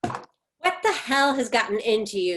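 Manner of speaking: ordinary delivery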